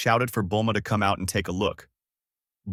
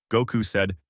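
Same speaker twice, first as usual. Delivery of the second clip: ordinary delivery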